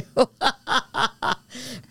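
high, bubbling laugh at own joke; real amused laugh. Real, not acted.

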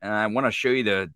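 usual speaking voice

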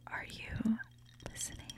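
very soft unvoiced whisper; ASMR whisper.

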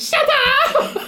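very high laughing squeal; squealed imitation. Cohost mimicking the clip's squeal, high and giggly. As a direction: squeal it back, mocking, laughing. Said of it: "echoing the squeal".

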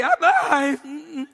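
high squeal, mock girly scream. Scared student at the needle, high mock girly squeal. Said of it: "I screamed like a middle school girl".

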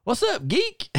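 very high mock heckling greeting; high-pitched imitated ribbing; Bunkhouse Buck. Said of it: "he'll say".

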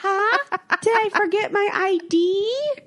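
high-pitched put-on innocence; mock clueless customer. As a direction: play dumb in a high, teasing voice. Improvises a teasing reply to bartender.

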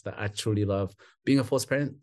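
ordinary talk

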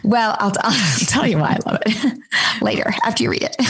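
whispered through laughter; half-laughing whispered tease; giggly whisper. Real, not acted. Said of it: "unvoiced laughing whisper".

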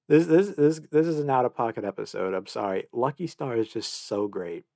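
usual speaking voice

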